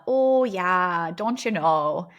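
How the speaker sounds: a light, mocking Minnesota accent, sing-song "don't you know". Mimicking how outsiders hear Minnesotans, light Minnesota accent. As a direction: poke fun at the thick Minnesota accent. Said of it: "especially when we speak like that".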